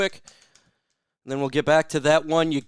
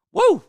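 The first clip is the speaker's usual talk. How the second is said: high loud whoop; woo; 7 dB louder than usual. Real, not acted. Streamer whooping for a viewer's birthday, joyful whoop. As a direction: let out a happy whoop.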